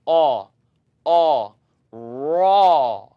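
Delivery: aw, aw, raw loud, lips still; tongue-made raw. Shown as a demonstration. Teacher isolates the vowel then says raw. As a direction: build from aw into raw, no lip rounding. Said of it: "the tongue that's being used to say".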